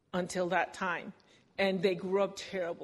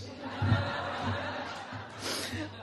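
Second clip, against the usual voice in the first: laughter; 8 semitones higher, 4 dB quieter than usual. Real, not acted. Audience laughter.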